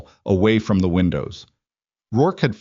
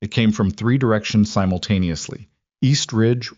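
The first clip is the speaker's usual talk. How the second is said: ordinary delivery, nothing marked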